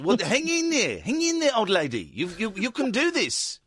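high, hammed-up Brighton accent; host teasing a caller in a mock Brighton accent. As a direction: jokingly put on a Brighton accent. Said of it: "I'm doing a Brighton accent".

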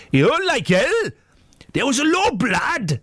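high-pitched hammed-up Geordie; Geordie accent. Radio host reading out Ant's quote from the paper, hammed-up Geordie. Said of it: "That's my Geordie accent".

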